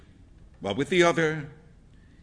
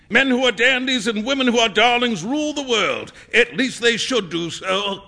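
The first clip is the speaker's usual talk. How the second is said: high, loud, declamatory painter; opinionated painter; 6 semitones higher, 6 dB louder than usual. A narrator's reading. Painter airing his views on people, declaiming. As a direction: hold forth grandly like a painter.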